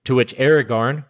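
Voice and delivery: normal talking voice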